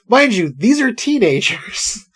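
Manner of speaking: usual speaking voice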